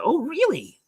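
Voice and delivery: high, swooping mock-intrigued question; feigned surprise. Client probing her coach about the rodeo, feigned surprise. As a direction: act out sudden, leading interest.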